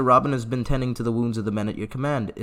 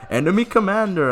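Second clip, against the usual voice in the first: higher, louder mock of the stiff phrase; mocking repeat; 8 semitones higher, 4 dB louder than usual. Player mocking the soldier's stiff wording. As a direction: parrot it to poke fun. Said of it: "I'm sure that's how they talk".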